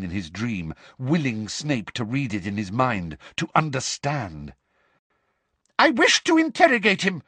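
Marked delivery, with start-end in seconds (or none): 5.8-7.2 high, louder furious shout; angry shout. A narrator's reading. Umbridge shouting at Snape to get the potion, angry. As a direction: bark the demand in rage. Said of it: "shouted Umbridge angrily".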